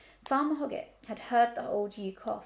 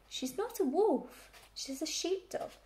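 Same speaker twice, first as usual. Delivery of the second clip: mildly hurt, high little voice; rather hurt; 6 semitones higher than usual. A narrator's reading. Pig defends his foster mother, mildly hurt. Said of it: "in a rather hurt voice".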